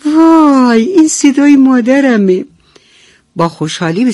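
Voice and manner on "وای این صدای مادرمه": much higher, louder happy duckling cry; joyful cry. Acted. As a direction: burst out with relief and joy. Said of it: "از خوشحالی فریاد کشید".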